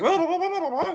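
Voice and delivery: very high, loud mock wailing; cartoon wailing. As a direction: squeal it like clown aliens. Clown aliens wailing.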